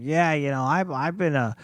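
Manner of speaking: put-on Boston Marine talk; Boston accent. Imitating Marines planning life after service, Boston accent. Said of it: "from Boston".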